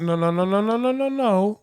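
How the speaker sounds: rapid string of no's climbing in pitch; insistent objection. Real, not acted. Rejecting a friend's caveat on All-Stars, insistent objection.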